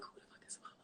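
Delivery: whispered, baffled question; whispered disbelief. Retold or imitated. Telling how she reacted to her sister, whispered. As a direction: breathe it out in a stunned whisper. Retold confused reply to sister.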